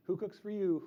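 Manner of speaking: the words hooted in an owl voice; teacher hoots the phrase for the kids; owl-call words. Shown as a demonstration. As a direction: sing it out like a barred owl. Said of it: "but in an owl noise".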